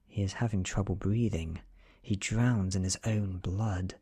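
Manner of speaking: plain reading voice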